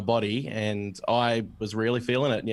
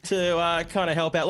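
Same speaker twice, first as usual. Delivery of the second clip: self-pitying whine; 8 semitones higher than usual. Retold or imitated. Retelling self-pity while sick alone.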